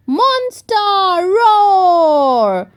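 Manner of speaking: slow, loud growling roar; monster roar. A narrator's reading. George in a monster costume, roaring slow and loud. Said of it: "shouted George".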